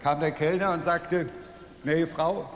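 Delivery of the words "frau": normal talking voice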